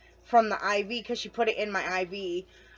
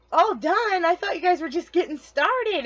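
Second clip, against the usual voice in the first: mock surprise; 8 semitones higher, 4 dB louder than usual. Surprised reply to nurse.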